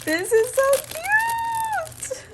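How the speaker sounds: high, slow, drawn-out gush; squealed with delight. Real, not acted. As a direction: melt over how cute it is. Gushing over Hello Kitty item.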